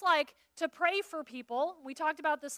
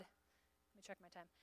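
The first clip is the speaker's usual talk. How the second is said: whispered aside, much softer; muttered aside; 7 semitones lower, 28 dB quieter, slower than usual. Real, not acted. Preacher checking the clock, whispered aside. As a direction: slip it in under your breath.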